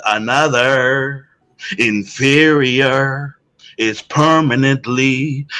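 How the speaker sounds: slow, solemn quoted speech; drawn out like reading an old speech; slow speech recitation. Retold or imitated. Quoting a famous speech, slow.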